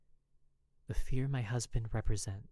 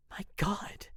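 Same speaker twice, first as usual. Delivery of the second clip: exasperated whisper; 9 semitones higher than usual. A narrator's reading. Narrator's exasperated aside.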